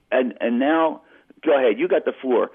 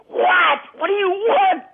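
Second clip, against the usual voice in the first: furious shout; slower than usual. Retold or imitated. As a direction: snap up the phone and bark at the caller. Grabs ringing phone, fed up.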